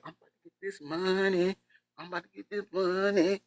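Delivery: high, soft mock whimper of a scheming mom; fake-crying scheming mom. Host mocks the grieving mom faking tears for money, high soft whimper. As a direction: whine it like she's faking tears for cash. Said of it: "pretending like crying, like".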